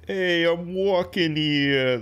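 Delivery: hammy New York tough guy, drawn out; New York accent. Shown as a demonstration. Guest demonstrates American accents to host in a New York accent. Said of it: "the New York accent".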